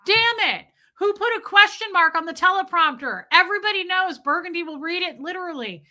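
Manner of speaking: high, loud frantic yell; mock panic at the prompter slip; mock newsroom outburst. Mimicking the Anchorman boss yelling about the prompter. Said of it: "And Fred Willard's like".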